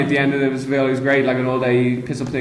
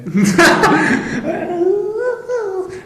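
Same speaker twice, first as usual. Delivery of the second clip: high laugh. Real, not acted. Laugh after the put-on voice.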